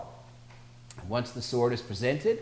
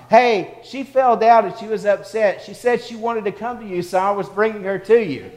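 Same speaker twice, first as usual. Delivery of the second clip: high, loud call across the room; imitated boy's yell; 10 semitones higher, 7 dB louder than usual. Young brother explaining himself to his mother, imitated yelling. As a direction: yell it over like an eager young boy. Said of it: "he yells over to his mom".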